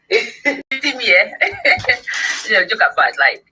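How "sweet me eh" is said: high, giggly, delighted delivery; tickled and breaking into high laughter; laughing. Real, not acted. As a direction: say it through real laughter. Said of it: "You know why I'm laughing?".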